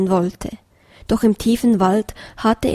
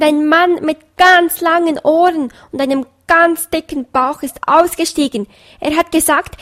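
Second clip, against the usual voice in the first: high, louder hen voice rushing her report; a flustered hen blurting news; hurried hen; 7 semitones higher, 4 dB louder than usual. A narrator's reading. Hen reports breathlessly to rooster. (hohe aufgeregte Hennenstimme)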